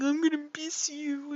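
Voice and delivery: wistful, hammed-up farewell; mock sad girlfriend. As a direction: imitate a sad little girlfriend pining. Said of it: "sad little girlfriend".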